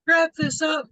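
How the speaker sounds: yawned out, high and loud; yawning. Real, not acted. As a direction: say it mid-yawn. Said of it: "There I go yawning".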